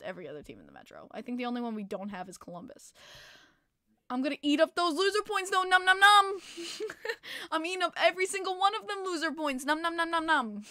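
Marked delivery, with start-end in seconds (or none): 4.1-6.4 high, loud gleeful munching taunt; gloating nom nom. Real, not acted. Streamer gloating to chat over loser points, high and loud. 7.5-10.6 gobble up the points with glee; gloating nom nom. Real, not acted. Gloating over rival's loser points.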